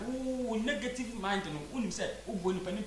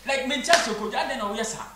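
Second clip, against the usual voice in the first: loud, pleading demand why; frustrated plea to Kojo; exasperated confronting; 4 semitones higher, 10 dB louder than usual. Retold or imitated. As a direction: call Kojo out, much louder. Confronting Kojo in imagined scene.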